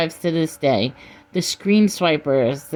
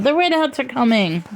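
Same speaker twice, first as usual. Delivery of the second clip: put-on higher-pitched child sounding the alarm; child's cry; 7 semitones higher than usual. A narrator's reading. Child repeating the warning as arrows fly, high cry. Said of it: "cried the child".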